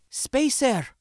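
high-pitched urgent alarm; hissed. A narrator's reading. Sindra waking the spacer to danger, hissing urgently. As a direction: jolt the sleeper awake with an accented warning. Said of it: "she hissed".

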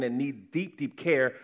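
ordinary delivery

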